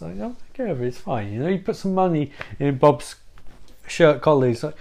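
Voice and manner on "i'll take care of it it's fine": a light, quiet mobster reassurance; lightly imitating a soothing Goodfellas gangster; Jimmy from Goodfellas. Dracula calming Bob like Jimmy in Goodfellas, light mobster voice. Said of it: "He's fucking Jimmy in Goodfellas".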